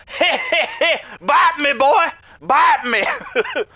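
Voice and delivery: put-on very high, cackling drunk hillbilly, slow on bite me boy. Moonshine drinker taunting the drunk snake, cackling high hillbilly voice. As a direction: giggle it out like a plastered backwoods moonshiner. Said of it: "he's like".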